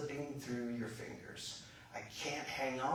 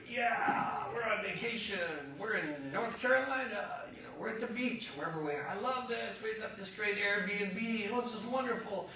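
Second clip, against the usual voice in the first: high, giddy holiday cheer; hammed-up delight, pitched high; gleeful enjoyment; 7 semitones higher than usual. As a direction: gush like a thrilled vacationer. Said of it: "you're just enjoying it".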